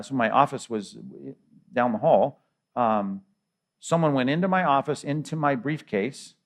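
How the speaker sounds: ordinary talk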